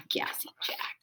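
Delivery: soft unvoiced whisper; a sly whispered aside. A narrator's reading. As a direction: breathe the name like a secret. Narrator's aside about Gassy Jack.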